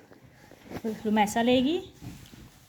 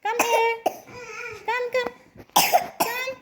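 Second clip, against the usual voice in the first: sing-song calling; 11 semitones higher than usual. Real, not acted. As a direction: coax the little one over in a sing-song. Coaxing the toddler to come over.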